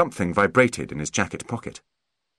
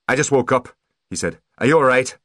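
urgent; 6 semitones higher, 5 dB louder than usual. Acted. Butler bursts in worried.

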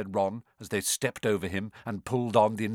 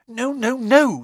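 flustered Hermione; 12 semitones higher, 8 dB louder, slower than usual. A narrator's reading. Hermione denies going out.

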